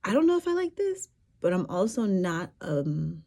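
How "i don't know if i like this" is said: much higher, uncertain thinking voice; unsure inner thought. Retold or imitated. Storyteller voicing private doubts about being flirted with, unsure. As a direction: voice a doubtful thought to yourself, pitched way up. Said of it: "I'm thinking to myself".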